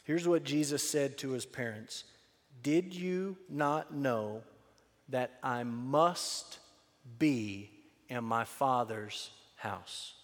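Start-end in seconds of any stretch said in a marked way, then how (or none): 2.6-10.1 very slow, each phrase set apart; solemnly spaced-out quotation; slow and deliberate. Acted. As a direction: draw the words out with pauses between. Scripture quoted slowly for emphasis.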